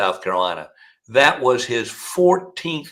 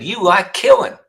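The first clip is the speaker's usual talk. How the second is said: much higher, louder taunt; high-pitched Pee Wee; 10 semitones higher, 6 dB louder than usual. Retold or imitated. Killer accusing the prosecutor, high and taunting. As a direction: jab it at him in a high voice. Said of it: "He said".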